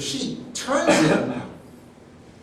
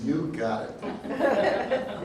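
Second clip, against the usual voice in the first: laughing; 5 semitones higher, much slower than usual. Retold or imitated. Friend's reply, laughing.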